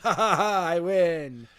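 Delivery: gloating villain cackle then boast; triumphant laugh. Retold or imitated. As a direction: laugh like you just stole the win. Mocking wrestler's gloating win.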